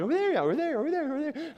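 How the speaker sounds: sing-song the nagging like a choir; little choir of kids. Retold or imitated. Kids in the back seat, a fading whiny chorus. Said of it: "A little choir, like".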